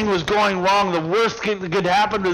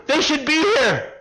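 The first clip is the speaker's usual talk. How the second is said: high, carrying woman's call; Miss Carol; 7 semitones higher than usual. Retold or imitated. Program leader looking for the hidden group, calling out. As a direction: lightly imitate a woman calling out. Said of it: "I, I hear".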